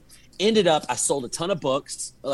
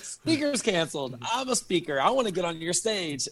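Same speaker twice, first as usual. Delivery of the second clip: eager inner reaction; 3 semitones higher, 4 dB quieter than usual. Retold or imitated. Acting out his eager inner reaction.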